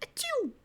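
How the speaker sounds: sudden high sneeze; sneezing. Real, not acted. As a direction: let out a real sneeze. Sneeze after warning.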